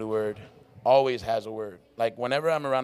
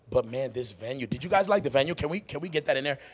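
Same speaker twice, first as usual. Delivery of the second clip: ordinary talk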